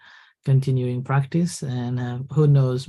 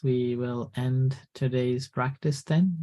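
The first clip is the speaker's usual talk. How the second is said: ordinary talk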